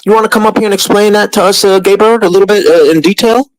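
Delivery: sing-song mockery; mocking call-out. Real, not acted. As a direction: tease someone into coming forward. Calling out to a viewer.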